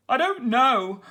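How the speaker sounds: high, loud pirate bluster; captain blustering that he can't do it. A narrator's reading. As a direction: huff it out in frustration. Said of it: "blustered Captain Stubble".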